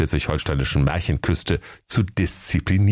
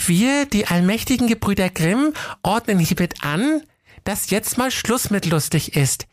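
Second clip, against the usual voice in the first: very high, prim voice reading the decree. Acted. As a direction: recite the stern letter in a girlish voice. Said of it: "las laut vor".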